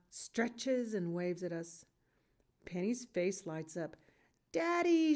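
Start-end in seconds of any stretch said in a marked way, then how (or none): 4.5-5.1 put-on high, loud child's call; little girl calling out to her father. A narrator's reading. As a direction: call across the yard to Daddy. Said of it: "she calls out".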